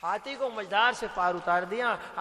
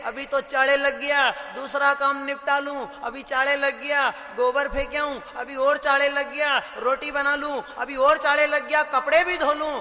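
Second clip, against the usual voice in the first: mother's busy thinking; 5 semitones higher, 9 dB louder than usual. Acted. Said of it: "तो माँ सोच रही है".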